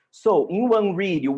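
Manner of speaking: ordinary talk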